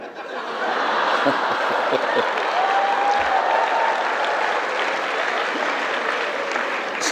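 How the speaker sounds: breathless wheezing laugh; real wheezing laughter; high silent laugh. Real, not acted. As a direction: laugh without voice, squeaking high. After story punchline.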